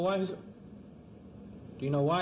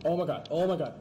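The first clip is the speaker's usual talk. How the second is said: normal talking voice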